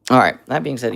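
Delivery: ordinary talk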